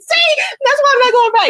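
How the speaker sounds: high, laughing retelling; speaking while cracking up, pitched high; mother laughing as she quotes her son; laughing through quote. Retold or imitated. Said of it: "he said".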